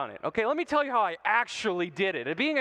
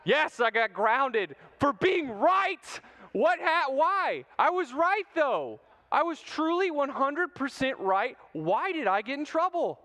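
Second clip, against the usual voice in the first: mock outrage; 7 semitones higher, slower than usual. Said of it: "mock outrage at being grounded".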